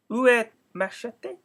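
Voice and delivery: slow, careful schoolgirl French for "Où est ma chatte?"; "Où est ma chatte?" said slow in textbook French; schoolbook French. Acted. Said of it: "the first sentence in her French lesson book".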